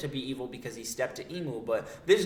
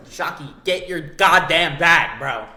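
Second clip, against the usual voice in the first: loud, drawn-out cheer; hype shout; 3 semitones higher, 11 dB louder, slower than usual. Real, not acted. Commentator hyping up Shocky for making money, hype shout. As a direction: egg her on, loud and slow.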